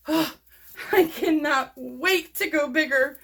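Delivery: slow, near tears; aching with delight; teary delight. Real, not acted. Sighing with delight.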